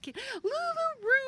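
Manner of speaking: high, drawn-out cheer; excited greeting. Real, not acted. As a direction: whoop the name, stretched and high. Greeting a friend joining.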